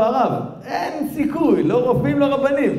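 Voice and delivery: high, emphatic retold plea; stretched, high cry of no hope; desperate insistence.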